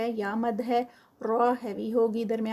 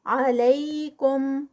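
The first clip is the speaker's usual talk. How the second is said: very slow recitation with the vowel drawn out; tajweed recitation; 2 semitones higher, 3 dB louder, much slower than usual. Shown as a demonstration. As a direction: draw the word out slowly in recitation. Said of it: "یہاں پر یا لین ہے". (کھینچ کر آہستہ تلاوت)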